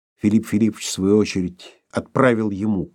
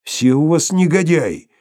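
frightened; 5 semitones higher, 4 dB louder than usual. A narrator's reading. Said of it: "испуганно ответил Шариков".

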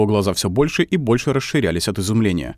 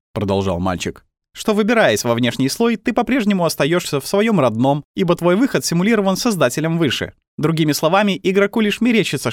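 put-on higher, wry boy's voice; smirking boy; 7 semitones higher than usual. A narrator's reading. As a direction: explain with a sly grin. Boy character speaking.

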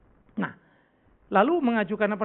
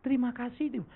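tearful thanks; 3 semitones lower, 6 dB quieter than usual. Retold or imitated. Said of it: "Dia nangis ya".

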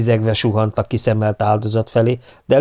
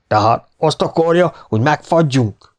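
mildly angry, higher-pitched challenge; 6 semitones higher than usual. A narrator's reading. Stoker challenging the officer about freezing, mildly angry. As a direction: demand an answer with a touch of anger. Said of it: "kérdezte a fűtő dühösen".

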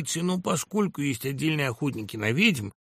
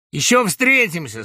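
loud, raised parting shot; spat threat; 5 semitones higher, 8 dB louder than usual. A narrator's reading. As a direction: throw a threat back on leaving. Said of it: "сплюнул Гусев". (злая угроза напоследок)

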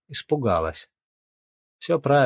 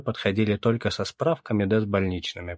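plain reading voice

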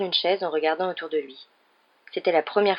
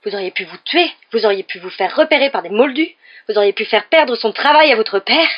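loud, higher, mounting tirade; furious yelling; 4 semitones higher, 9 dB louder than usual. A narrator's reading. Mother listing what could have happened, furious yelling. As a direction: pile up the accusations, louder on the last. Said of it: "Elle sembla hurler ainsi".

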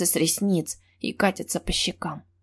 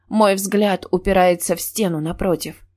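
ordinary delivery

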